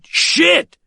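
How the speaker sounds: sudden high yelp of alarm; startled shout. Acted. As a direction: blurt it out as the plan collapses. Startled curse in standoff.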